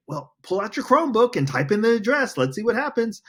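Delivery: mock teacher instruction. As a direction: play the eager teacher handing out the task. Principal voices an instruction to class.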